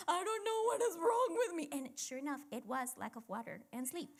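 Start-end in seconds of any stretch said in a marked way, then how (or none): 0.1-1.7 high, loud, frantic; mock panic at oneself; exasperated self-quote. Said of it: "I'm more like".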